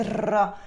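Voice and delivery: R trilled with the tongue tip; a demonstrative trilled R; rolled R. Shown as a demonstration. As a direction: imitate a rolled, non-French R. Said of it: "he rolls his R's".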